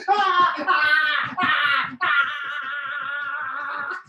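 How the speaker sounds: high, hammed-up screaming cries; mock scream. Final pretend lava escape, hammed-up screams. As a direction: wail like a disaster-movie victim.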